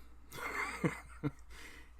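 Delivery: breathy laugh at his own joke; a quick unvoiced chuckle; airy laugh. Real, not acted. Vlogger laughing at his own mock scolding, airy and brief.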